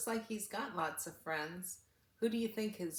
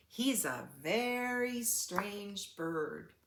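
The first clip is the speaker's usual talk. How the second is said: slow, drawn-out duck voice; slow puzzled duck voice; 2 semitones higher, slower than usual. Acted. The duck doubts the newcomer, slowly and suspiciously. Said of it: "said Duck".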